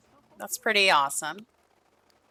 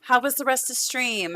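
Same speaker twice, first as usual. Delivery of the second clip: cooing to her Sim; 5 semitones higher than usual. Real, not acted.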